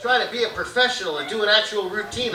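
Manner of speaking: usual speaking voice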